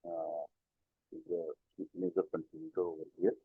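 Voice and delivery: usual speaking voice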